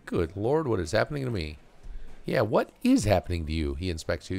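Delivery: ordinary talk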